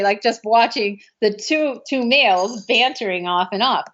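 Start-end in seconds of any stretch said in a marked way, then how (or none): none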